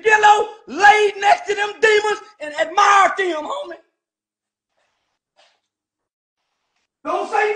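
real fury, shouting down the hypocrites; angry shouting rant. Real, not acted. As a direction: yell it at the whole industry. Angry rant at rap industry.

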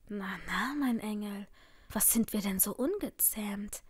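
soft, breath-heavy taunt; creepy mock soothing. A narrator's reading. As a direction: chide her softly, like a menacing lover. Stalker mockingly soothes his victim. (leise, höhnisch tadelnd)

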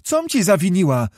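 put-on high, plaintive girl's voice; frightened protest. A narrator's reading. Girl asks her captor what wrong she did, high frightened protest. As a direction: protest your innocence, pitched high.

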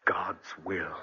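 whispered, low and disbelieving; bitter whisper. Acted. Husband questioning his dying wife's faith, bitter whisper.

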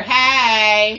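very loud, campy "Hey"; shouted campy greeting; flamboyant weregay call. Retold or imitated. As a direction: belt out a big sassy hey. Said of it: "you would hear".

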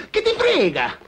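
what do you care, shouted up high; loud exasperated repeat. Acted. Friend brushes off question, repeated.

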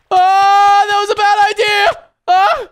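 very high, loud yelp; panicked shout. Real, not acted. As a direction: scream as you fall. Falls after a bad move.

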